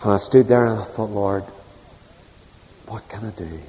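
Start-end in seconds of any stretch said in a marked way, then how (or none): 1.1-3.6 hushed prayer. Retold or imitated. Retold silent prayer at scene.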